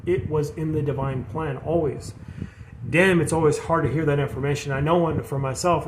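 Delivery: ordinary talk, nothing marked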